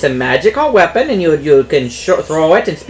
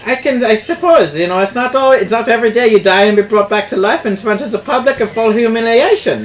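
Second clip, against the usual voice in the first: higher put-on merchant voice; shopkeeper NPC; 7 semitones higher than usual. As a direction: greet the customer as a chatty shopkeeper. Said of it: "my favorite N P C".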